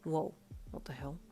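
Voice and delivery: soft, low inner voice; muttered to himself in surprise; quiet low surprised thought; thought aloud. A narrator's reading. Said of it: "he thought with surprise".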